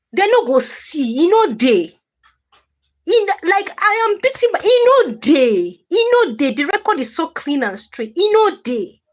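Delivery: high, loud defiant rant; heated defiance. Real, not acted. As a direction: rise up defiantly at the haters.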